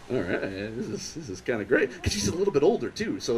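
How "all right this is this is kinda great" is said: low, soft, pleased aside; quiet pleased murmur. Retold or imitated. His own quoted thought.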